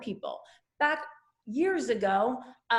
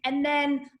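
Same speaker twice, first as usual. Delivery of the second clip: ordinary delivery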